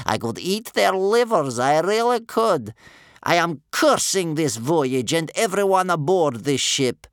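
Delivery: put-on loud, venomous, scary old woman; spitting furious grandmother. A narrator's reading. Chained grandmother curses her Viking captors, spitting fury. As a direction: spit out each curse with relish. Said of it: "she spat into the darkness".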